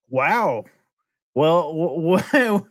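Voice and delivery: mock stammering shock, pitch climbing; stunned stammer. Missionary reacting to the chicken dropping dead, a mock stammer. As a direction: trip over the words in disbelief.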